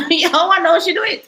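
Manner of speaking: amused chuckling retake; laughing. Real, not acted. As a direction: laugh through the repeat. Repeats line laughing.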